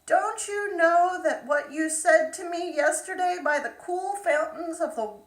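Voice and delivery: high, loud frog calling through the door. Acted. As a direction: call up to her, insistent. Frog knocks again.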